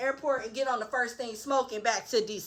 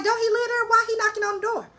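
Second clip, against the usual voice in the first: surprised; 11 semitones higher, faster than usual. Real, not acted. Confused outburst.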